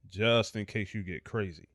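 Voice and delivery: usual speaking voice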